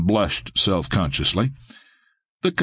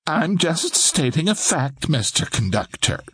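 higher, prim lady's voice; admonishing lady; 7 semitones higher than usual. A narrator's reading. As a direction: correct him primly, as a portly lady. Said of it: "the portly lady admonished".

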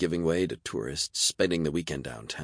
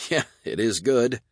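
laughing; 7 semitones higher, 5 dB louder than usual. A narrator's reading. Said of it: "Barry laughed".

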